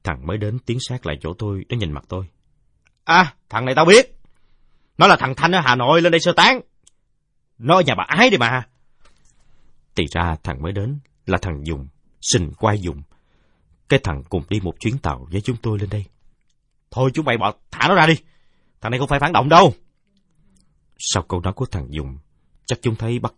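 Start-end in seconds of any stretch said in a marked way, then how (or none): 3.0-8.7 put-on loud, high, eager boy; excited recognition. 16.9-19.7 loud, high order to the gang; commanding shout. Acted. Boy orders others release captive.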